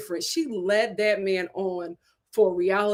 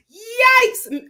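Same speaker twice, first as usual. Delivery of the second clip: shrill yikes; 11 semitones higher, 9 dB louder than usual. Real, not acted. Reacting to harsh breakup.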